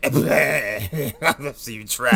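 mock slurred drunk voice; drunk taunt. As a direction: draw it out like a hammed-up drunk. Acting drunk Achilles' slurred taunt.

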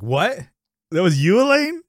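rising, loud, high amazement; Kramer stunned. Retold or imitated. Recapping the episode, Kramer's astonished high shout. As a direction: gasp out the disbelief. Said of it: "Kramer over here is like".

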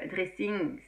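ordinary talk

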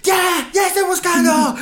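high, slow mock yell of a hungry friend; mock angry shout. Podcaster imitates hungry Marijo snapping at Mike, mock angry yell.

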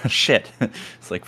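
usual speaking voice

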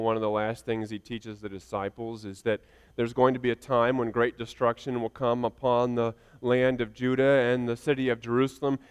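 ordinary talk, nothing marked

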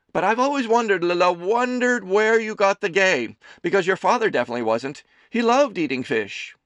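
high, loud doting mother voice; a fussing mother teasing her son; Amelie's voice. A narrator's reading. Mother serving her son lunch, high and fussing.

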